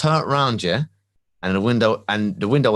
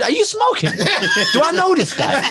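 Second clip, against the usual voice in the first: very high, hammed-up disbelief; incredulous; 3 dB louder, slower than usual. Retorting to his brother on the phone, high incredulity. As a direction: squeal the retort in mock outrage.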